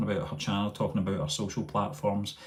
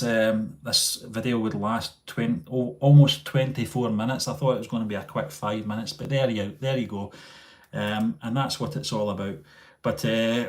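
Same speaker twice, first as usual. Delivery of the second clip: ordinary talk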